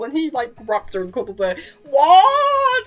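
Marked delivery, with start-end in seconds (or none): 1.8-2.8 squealed what. Real, not acted. Real reaction to the cute scene.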